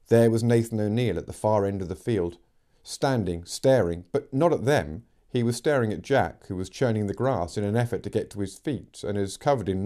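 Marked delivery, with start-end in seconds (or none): none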